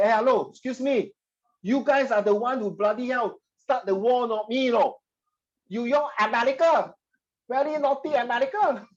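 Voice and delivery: high, mocking scold at America; sarcastic mocking. Teacher voicing the source's mocking tone toward America, high and sarcastic. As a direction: jeer at them like a cheeky accuser. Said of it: "I'm just portraying the tone, being sarcastic, mocking".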